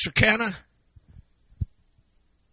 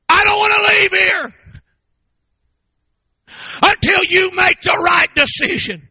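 earnest plea; 10 semitones higher, 6 dB louder than usual. Retold or imitated. Acted plea of the kneeling preacher.

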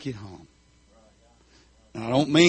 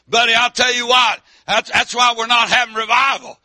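loud, raised country-preacher voice; full-force preaching; 7 semitones higher, 6 dB louder than usual. Acted. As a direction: preach it hard to the congregation.

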